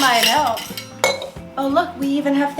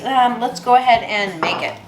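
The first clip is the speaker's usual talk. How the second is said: normal talking voice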